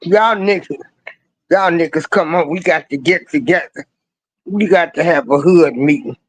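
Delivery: low, fast, casual street call to the homies; lightly voiced true brother. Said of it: "A true brother".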